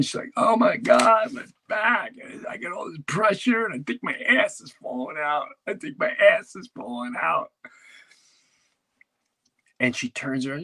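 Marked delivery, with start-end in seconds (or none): 0.4-7.5 high, strained shouting wife; shrill panicked wife crying about her back; wife in labor. Retold or imitated. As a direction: yell it like a wife in labor pain. Wife in labor pain, voiced by comic.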